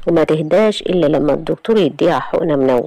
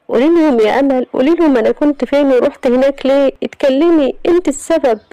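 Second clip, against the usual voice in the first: much higher and louder, frantic; desperate plea; 10 semitones higher, 3 dB louder than usual. Acted. Iman frantically begging her cousin Amal to speak up. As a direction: shake the words out of her cousin.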